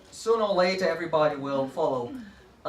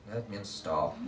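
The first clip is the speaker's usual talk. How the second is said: class response; 10 semitones lower, 8 dB quieter than usual. Shown as a demonstration. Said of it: "and they".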